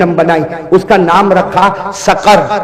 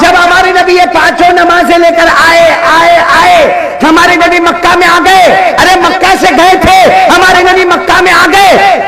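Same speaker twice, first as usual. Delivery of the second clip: raised, ringing sermon voice; preaching at full force; 11 semitones higher, 4 dB louder than usual. Acted. Preacher retelling the Prophet's plea to a village crowd, ringing and raised. Preacher narrating return to Mecca.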